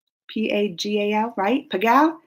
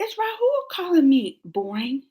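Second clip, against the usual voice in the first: gasp, then high-pitched disbelief; mock shock; 6 semitones higher, 4 dB quieter than usual. Vlogger thinks Rahul called her boring, mock shocked. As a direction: play scandalized, pitched up.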